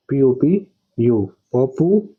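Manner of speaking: पी ओ पी यू slowly as letters, then पॉपू; spell then sound out. Shown as a demonstration. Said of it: "ऐसे पढ़ते हैं ना".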